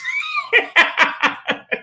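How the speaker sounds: high-pitched burst of laughter. Real, not acted. To the listeners after the fish story, a high burst of laughter. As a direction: crack up high at your own story.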